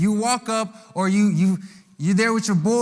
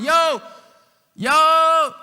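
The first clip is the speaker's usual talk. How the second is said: loud, high hail across the crowd; calling out; 7 semitones higher, 5 dB louder than usual. Acted. Disciples hailing the crowd while hunting for bread. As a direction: call out to get people's attention.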